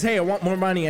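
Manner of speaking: ordinary talk, nothing marked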